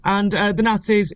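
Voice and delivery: high-pitched stumbling first attempt; nervous beginner. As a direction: imitate yourself fumbling a rehearsed pitch. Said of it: "When I first started doing it".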